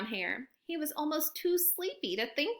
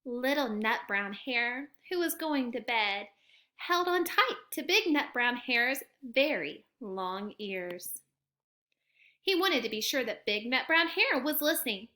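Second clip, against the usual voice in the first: ordinary delivery, nothing marked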